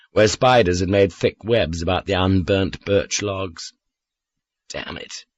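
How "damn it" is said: under the breath; whispered thought. A narrator's reading. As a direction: whisper it to yourself. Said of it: "I thought".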